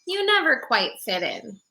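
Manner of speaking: put-on bright storybook narration for kids; gentle picture-book reading voice; reading to children. A narrator's reading. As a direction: read it like storytime on the rug. Reads picture book aloud.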